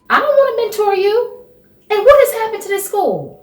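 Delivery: high, loud and fed up; exasperated. Acted. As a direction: push back, loud and annoyed. Storm refuses, exasperated.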